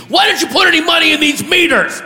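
high, outraged yell; mock scream. Furious parking officer to driver, high screamed demand. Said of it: "he screams to me".

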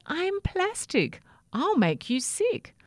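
high-pitched alarmed warning; protesting toothbrush. Acted. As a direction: warn off the hungry bird, pitched high. Sammy protests being eaten.

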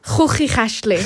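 Hooky Ashley with harsh throat sounds, xuxi xaʃtli; throaty Dutch pronunciation. Shown as a demonstration. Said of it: "Say it again".

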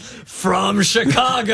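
drawn-out announcer-style shout; slow, hammed-up holler; hometown cheer. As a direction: stretch it out like a proud hype call. Mock hometown-pride cheer.

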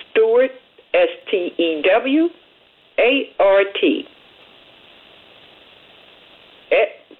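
letters spelt slowly with long pauses; spelling it out. Shown as a demonstration. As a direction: spell every letter for people writing it down. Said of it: "I'm gonna say it one more time".

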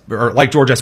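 ordinary talk, nothing marked

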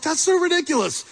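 high, scoffing disbelief; mock outrage, it's absurd; incredulous. Crowd hearing Jesus' story, high scoffing disbelief. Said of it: "everybody listening is like".

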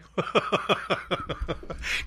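soft unvoiced laugh; breathy laugh. Real, not acted. Host laughing at his own bye-bye bit, softly and breathless. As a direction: laugh quietly at the own joke.

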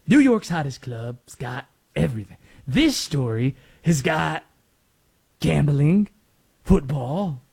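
hint of a sketch-comedy character; Stefan. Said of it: "to quote Stefan from S N L".

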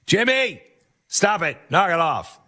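a mother telling her son to knock it off; mom scolding. Retold or imitated. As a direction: scold him like an exasperated mom. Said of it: "what my mom would say".

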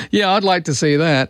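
ordinary talk

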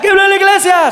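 high-pitched mock announcer shout; announcer call. Hype man introducing comic to crowd, high announcer call.

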